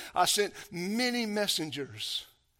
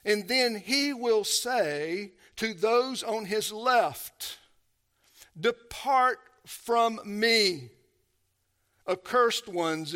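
slow, weighty reading of the verdict; solemn scripture reading; 6 semitones higher, 7 dB louder, slower than usual. Acted. Preacher reading Jesus' words of judgment, slow and heavy. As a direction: lean hard on depart, slowly.

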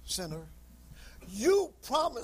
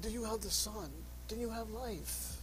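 softer, probing question; quiet earnest question; 8 dB quieter than usual. Retold or imitated. Preacher retelling asking a man on the street, softly. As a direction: ask it gently, close in.

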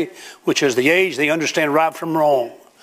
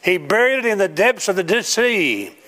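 rising preaching; 7 semitones higher than usual. Acted. Preaching climax, raised voice.

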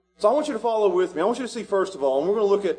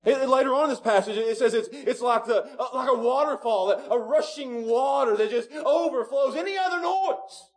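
raised-pitch, fervent preaching; preaching hard; 5 semitones higher than usual. Acted. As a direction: preach it with building conviction. Preaching about Jesus' voice.

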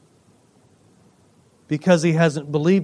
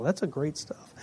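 whispered aside; 3 semitones lower, 15 dB quieter than usual. Real, not acted. Whispered aside about bread.

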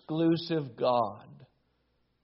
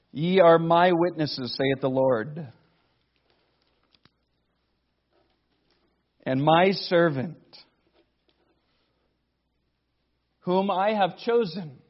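louder, solemn reading of scripture; 2 semitones higher, 5 dB louder than usual. Acted. Preacher reads scripture aloud.

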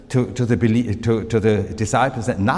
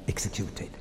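executed whispered, much softer; 5 semitones lower, 14 dB quieter, faster than usual. Acted. Preacher recounting Jesus' death, hushed whisper. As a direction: breathe executed out in a hush. Dramatic end of the Passion list.

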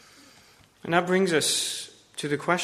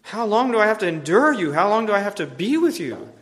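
louder, higher cry of exasperation; exasperated frustration; 5 semitones higher, 6 dB louder than usual. Acted. Jesus fed up with the faithless crowd, exasperated. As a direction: let the weariness break out loud. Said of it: "Jesus, in his frustration".

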